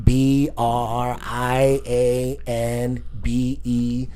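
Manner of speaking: very slow letter-by-letter recitation; deliberate reenacted spelling; concentrated spelling. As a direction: spell it out one careful letter at a time. Spelling names to hold off climax.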